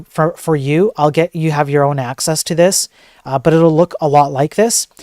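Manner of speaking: ordinary talk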